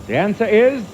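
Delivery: put-on slight German accent on the quiz answer. Host reading a Germany quiz clue, slight German accent. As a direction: slip lightly into a German accent. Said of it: "slipped into a German accent".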